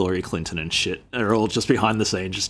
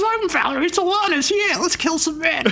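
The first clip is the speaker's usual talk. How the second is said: a man faking a much higher woman's voice, hammed up; high-pitched voice. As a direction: squeak it out in a put-on falsetto as a joke. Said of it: "putting on really high-pitched voices".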